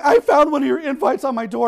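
high, loud tearful complaint; exaggerated weepy caller; mock crying. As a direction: imitate an upset woman wailing down the phone. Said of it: "and she's crying".

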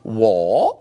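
"wa" starting low and rising sharply; rising tonal "wa"; tone demonstration. Shown as a demonstration. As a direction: swoop "wa" upward from a deep start. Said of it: "all the different ways you could say".

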